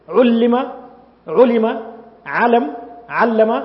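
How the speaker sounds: علم repeated with different short vowels each time, the last ones slowed; vowel pattern demo. Shown as a demonstration. Teacher showing students the many forms of علم, careful vowel demo. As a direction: spell out each vowel form of علم slowly. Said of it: "هذه صيغ مخت-، متعددة".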